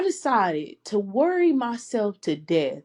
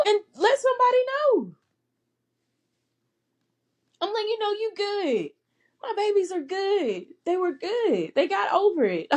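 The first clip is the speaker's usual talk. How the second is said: high, crying through the words, then settling; tearful, voice breaking; 10 semitones higher than usual. Real, not acted. Mother recalling her babies' recovery, crying and relieved. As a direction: let the tears come as you say it.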